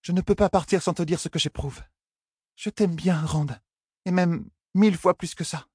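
high-pitched heroine declaring affection; earnest, high young woman's voice; young woman confessing. Acted. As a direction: confess your feelings in a high feminine voice. Heroine confesses her love.

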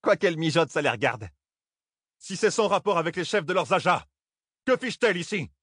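high-pitched indignant outburst; thundering. A narrator's reading. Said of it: "tonna Thalène". (tonné avec colère, voix aiguë)

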